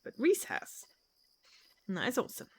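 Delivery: ordinary delivery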